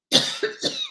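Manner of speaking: sudden dry cough; a harsh real coughing fit. Real, not acted. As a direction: cough hard mid-sentence, caught off guard. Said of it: "you've got me coughing now".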